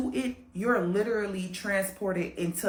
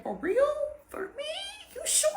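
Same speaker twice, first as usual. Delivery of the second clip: giddy mermaid. Acted. Mermaid answers man in story.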